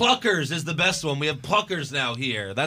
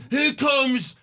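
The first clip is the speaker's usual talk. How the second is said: mocking British announcer accent; 8 semitones higher than usual. Podcaster mimicking the new announcer, mock British accent. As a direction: exaggerate an obnoxious British accent, pitched up. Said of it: "his fucking accent is so damn annoying".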